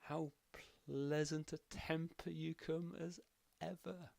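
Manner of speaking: sweetly mock her temper; pleasant, sarcastic. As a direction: Danilo greeting the Queen with silky sarcasm. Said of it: "he says pleasantly".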